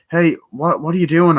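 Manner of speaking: high, loud what are you doing; startled shout. Retold or imitated. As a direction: barge in loudly, caught off guard. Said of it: "he comes in like".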